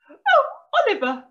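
high, loud "oh" that drops on the name; excited squeal. A narrator's reading. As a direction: squeal like a fussing mum. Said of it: "his mum squealed".

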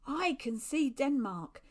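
drawn-out, moderately loud sighting call; milder excited call. A narrator's reading. Same call repeated after a break.

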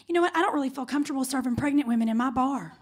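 normal talking voice

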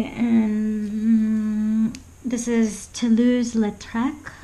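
mock French accent, slow and drawn out; bad French accent. Shown as a demonstration. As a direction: put on a clumsy French accent for the painter's name. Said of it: "Excuse my bad French accent".

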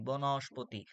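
ordinary talk, nothing marked

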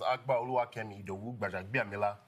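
"Akbar Oluwakemi Idowu Bajabiamila" with Nigerian accent, pure open vowels. Shown as a demonstration. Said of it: "With the Nigerian accent".

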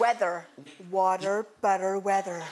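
low, deep American Water, butter, weather; low sexy American accent. Shown as a demonstration. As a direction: drop it down low and sultry in American. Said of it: "that was very sexy".

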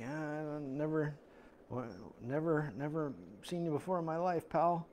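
soft, guarded mock reply; wary denial. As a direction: quietly fend off a stranger. Acts out wary reply to stranger.

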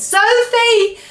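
high, loud call; mistaken shout. A narrator's reading. BFG calls for hidden Sophie, shouting. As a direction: call the name at full voice. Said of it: "Oh, sorry".

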